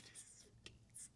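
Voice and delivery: whispered with a laugh; breathy laughing whisper aside. Real, not acted. Host whispers to viewers about fake cats, laughing.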